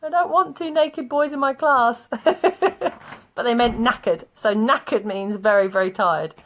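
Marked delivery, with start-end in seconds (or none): 0.0-2.1 high wailing oh no, then a dismayed protest; hammed-up teacher dismay; mock horror. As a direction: ham up the shocked teacher. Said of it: "And we go".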